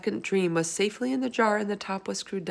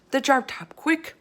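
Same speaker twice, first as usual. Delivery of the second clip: high, loud hurried urging; urgent plea; 8 semitones higher, 5 dB louder than usual. Acted. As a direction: hurry her along. BFG urging Sophie.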